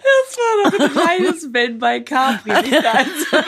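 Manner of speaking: high-pitched, laughing through the words; helpless laughter while talking. Real, not acted. Both laughing after gargling songs.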